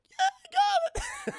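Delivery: very high, loud, slow pained voice; strained squeak. Retold or imitated. Johnny after the kick, very high strained voice. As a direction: squeeze it out in pain. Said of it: "He's like".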